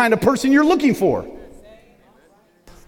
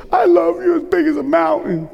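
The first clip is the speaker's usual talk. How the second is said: lightly voiced small girl, pitched higher; a hint of a toddler answering her daddy; little daughter's voice; 9 semitones higher than usual. A preacher voicing his toddler daughter, lightly higher. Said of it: "She said".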